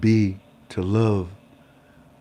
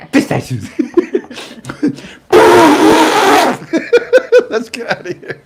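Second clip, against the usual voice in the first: high, loud laughter; laughing; 9 dB louder than usual. Real, not acted. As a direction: burst out laughing. Laughing fit.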